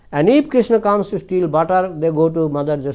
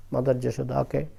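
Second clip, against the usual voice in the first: asking forgiveness; 4 semitones lower, 6 dB quieter than usual. A narrator's reading. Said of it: "gopi asked forgiveness".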